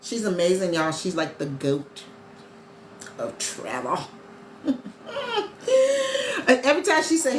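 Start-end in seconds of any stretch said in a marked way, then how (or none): 4.6-6.4 long high-pitched laugh; real laugh. Real, not acted. Streamer praising her coach's travel skills, laughing.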